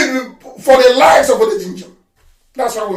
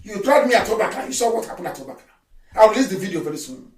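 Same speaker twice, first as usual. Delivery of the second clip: ordinary talk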